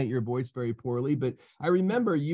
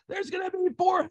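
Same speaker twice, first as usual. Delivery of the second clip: much higher put-on voice for the CEO; imitating Reed; 14 semitones higher than usual. Retelling the new CEO's rallying call in a much higher voice. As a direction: lift the voice way up to mimic Reed, not your own. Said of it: "He doesn't talk like me".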